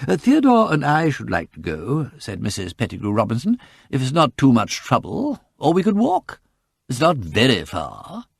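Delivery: a proper, fussy matron offering to walk; prim churchgoing lady. A narrator's reading. Mrs Pettigrew Robinson speaks.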